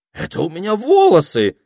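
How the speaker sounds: high, loud indignation; возмущённо. A narrator's reading. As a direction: protest hotly, pitch jumping up. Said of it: "возмутилась Пенелопа". (громкое возмущение, высоким голосом)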